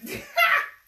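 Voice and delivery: bright laugh, pitched well up; high loud laugh. Real, not acted. Mother laughing at the coconut violin.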